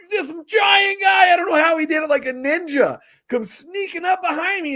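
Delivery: high, loud, slowed telling; building up the giant sneaking up; excited. Real, not acted. Excited storytelling of ambush.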